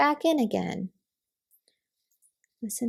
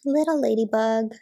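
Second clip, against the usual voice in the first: plain reading voice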